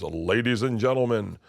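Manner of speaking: drawn-out announcer build, rising high; loudspeaker announcer. Retold or imitated. Loudspeaker voice introducing tap dancers to the audience, big announcer. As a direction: imitate a showman introducing an act. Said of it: "this voice on the loudspeaker goes".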